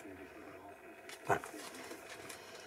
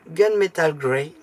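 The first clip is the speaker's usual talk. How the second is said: put-on slow, drawn-out English accent on a colour name; 8 dB louder, slower than usual. Crafter reading her paint label in an English accent. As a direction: say the paint name like a proud Brit, slow and loud. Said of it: "j'adore mon accent anglais".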